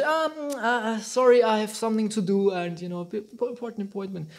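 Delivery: hesitant stammering excuse, trailing away softly; mock nervous excuse. Coward stammering excuses to dodge a fight, hesitant and fading. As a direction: play a coward dodging a duel.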